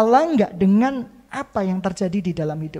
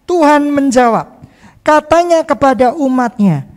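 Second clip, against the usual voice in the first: much louder, higher proclamation of scripture; preaching at full force; 3 semitones higher, 8 dB louder than usual. Acted. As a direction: proclaim God's promise to the people. Reading scripture aloud, full force. (membacakan firman dengan lantang)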